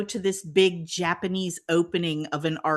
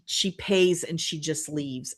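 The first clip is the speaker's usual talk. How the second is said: ordinary talk, nothing marked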